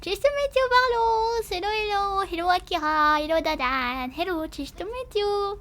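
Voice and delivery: bright, high sing-song hellos; high cheerful greeting. Real, not acted. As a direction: greet them like a cartoon.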